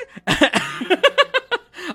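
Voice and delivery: high bursting laugh at own joke; real high-pitched laughter; delighted laugh. Real, not acted. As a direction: laugh out loud, tickled. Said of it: "I love that joke".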